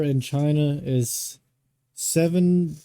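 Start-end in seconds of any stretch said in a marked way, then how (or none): none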